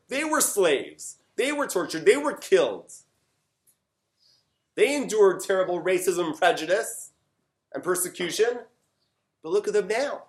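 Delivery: high, emphatic preaching; impassioned exhortation. Retold or imitated. Man exhorting his community to learn from others, impassioned.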